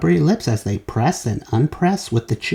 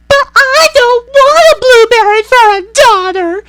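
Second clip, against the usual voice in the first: very high, loud outraged mother; yelling; 11 dB louder than usual. A narrator's reading. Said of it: "yelled Missus Beauregard".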